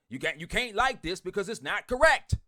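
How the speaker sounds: usual speaking voice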